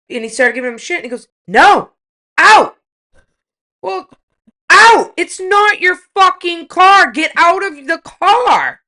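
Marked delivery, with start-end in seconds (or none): 1.5-8.8 loud, high, slow shouting; retold angry shout. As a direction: order him out, word by word. Husband throwing man out of car.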